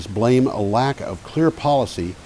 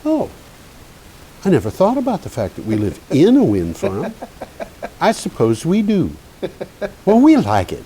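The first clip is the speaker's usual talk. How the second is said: higher voice, dawning realization; retold woman's voice; 8 semitones higher than usual. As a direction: imitate a woman pondering, then cheerfully deciding. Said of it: "I talked to a woman who just answered the phone".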